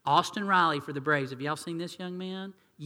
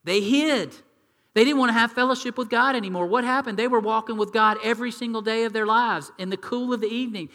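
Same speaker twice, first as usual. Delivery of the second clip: loud, high, driving preacher voice; full force preaching; 5 semitones higher, 6 dB louder than usual. Acted. Pastor telling the church of Eden, preaching hard. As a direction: preach it out to the congregation.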